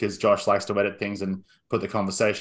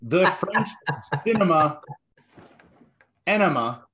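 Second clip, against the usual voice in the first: drawn-out, announcer-style host introductions; showman intro; 5 semitones higher, much slower than usual. Acted. Podcast host introducing co-hosts like a wrestling ring announcer.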